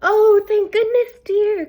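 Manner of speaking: a kindly grandmother welcomes her granddaughter; relieved Grandma. A narrator's reading. Grandma relieved to hear the knock, in a high granny voice. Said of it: "said Grandma".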